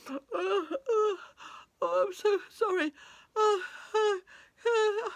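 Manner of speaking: loud, high hammed-up sobbing; acted bawling, gulping for breath; crying woman. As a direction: sob the apology out between wails. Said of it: "Why are you crying?".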